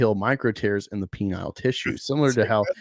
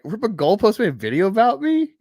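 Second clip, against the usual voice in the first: mock disbelief; 7 semitones higher than usual. Retelling his shock to the hosts, high and incredulous. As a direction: sound floored at the news. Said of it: "I'm like".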